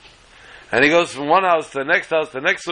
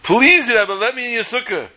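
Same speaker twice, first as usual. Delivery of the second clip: desperate begging; 7 semitones higher than usual. Acted. Guest begging the Rebbe for his sukkah, desperately pleading. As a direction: beg hard, pitch raised throughout. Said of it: "He starts to beg and to plead".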